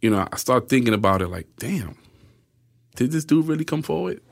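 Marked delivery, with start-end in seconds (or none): 1.6-4.2 higher-pitched musing to himself; retold wondering disbelief. Prisoner wondering if confessor really came forward, mildly amazed. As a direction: think aloud in mild amazement. Voices his own dawning doubt.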